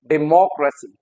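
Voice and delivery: "democracy" as di-MOCK-ruh-see; stress on MOCK. Shown as a demonstration. Teacher repeating the right form, stressed on MOCK. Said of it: "mock पे जो है".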